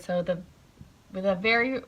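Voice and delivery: usual speaking voice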